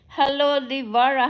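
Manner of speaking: ordinary talk, nothing marked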